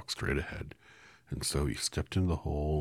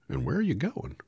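higher, louder curious straw man; lightly voiced Scarecrow; 11 semitones higher, 6 dB louder than usual. Scarecrow asks Dorothy who she is, higher and louder. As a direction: pop the question up high.

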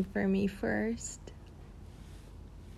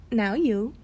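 ordinary talk

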